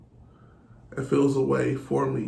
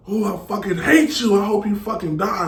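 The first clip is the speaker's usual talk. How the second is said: retold angry outburst; 7 semitones higher, 5 dB louder than usual. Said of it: "he's saying this shit".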